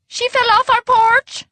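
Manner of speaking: very high, loud wail of distress; crying out. A narrator's reading. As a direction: cry out in alarm at a high pitch. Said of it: "cried Frau Hoffner".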